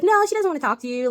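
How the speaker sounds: high, loud, sniffy refusal; haughty Emily. Retold or imitated. Emily refusing Lorelai on the phone, high and haughty.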